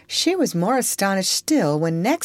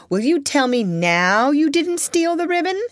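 stern challenge; 11 semitones higher, 4 dB louder than usual. Acted. Triumphant aunt confronts.

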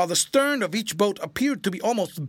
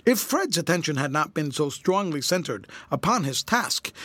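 ordinary delivery

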